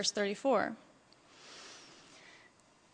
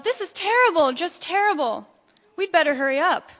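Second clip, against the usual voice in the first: raised, urgent storybook yell; alarmed yell; 5 semitones higher, 5 dB louder than usual. A narrator's reading. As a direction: start high and loud, then ease slightly. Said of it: "Ducky Lucky yelled".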